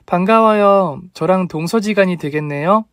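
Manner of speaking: happy greeting to the future sister-in-law; cheerful greeting. A narrator's reading. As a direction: greet the new in-law brightly.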